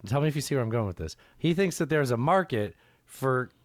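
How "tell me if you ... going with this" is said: ordinary delivery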